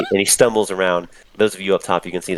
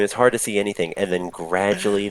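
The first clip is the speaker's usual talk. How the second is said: usual speaking voice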